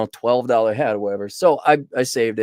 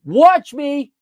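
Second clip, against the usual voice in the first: defiant; 12 semitones higher, 7 dB louder than usual. Retold or imitated. Defiant retort to a challenge.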